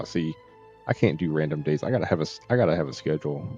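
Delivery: ordinary talk, nothing marked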